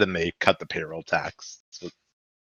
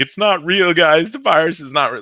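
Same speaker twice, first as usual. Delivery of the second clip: high, louder mock protest; mock denier; 8 semitones higher, 4 dB louder than usual. Podcaster mocking virus deniers, high, louder mock voice. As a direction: play a careless partygoer waving it off. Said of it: "being like".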